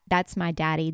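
ordinary delivery

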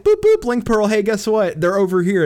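high, loud mocking caller with beeping noises; mock phone call. Mocking a story character's phone call, high and loud.

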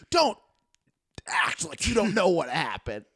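retold scolding, words stretched out; upset accusation. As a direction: imitate an upset friend confronting someone. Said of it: "she's getting so upset".

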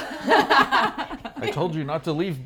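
high, loud and slow mock scolding; mock yelling. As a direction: yell it at the noisy neighbor, laughing. Mock scolding the leaf blower.

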